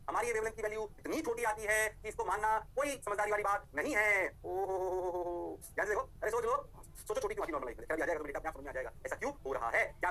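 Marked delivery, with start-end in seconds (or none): 4.4-5.6 long, slow run of oho-hos on one note; drawn-out "oho-ho". Acted. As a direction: stretch the ohos out slowly and evenly. Teacher exclaims over tiny value.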